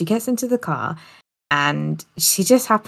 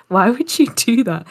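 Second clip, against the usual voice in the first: mock hurt husband; 5 semitones higher, 4 dB louder than usual. Husband confronts wife on phone.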